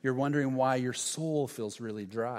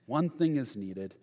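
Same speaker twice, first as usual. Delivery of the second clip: ordinary talk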